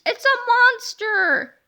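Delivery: high, loud, slow panicked scream; frightened shriek. Acted. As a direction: scream it at the monster in terror. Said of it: "the frightened principal shrieked".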